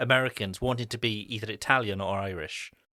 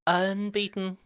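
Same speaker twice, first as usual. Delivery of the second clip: much higher, held long; drawn-out call; 11 semitones higher, 3 dB louder, slower than usual. Pretend. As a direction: stretch out UNBEATEN on a high, level note. Repeats the word in drawn-out high voice.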